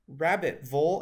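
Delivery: ordinary delivery, nothing marked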